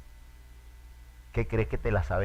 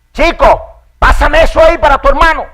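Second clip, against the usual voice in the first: put-on much higher, louder spoiled child ordering; bossy little kid; 20 dB louder than usual. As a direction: order the big brother around like the baby. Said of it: "le habla con chico". (el hermanito menor mandón, agudo y fuerte)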